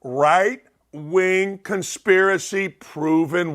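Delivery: very slow, drawn-out emphasis; slow, deliberate. Real, not acted. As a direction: spell it out slowly, word by word. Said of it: "I wanna say it slowly".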